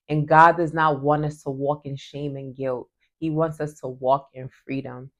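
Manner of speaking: ordinary talk, nothing marked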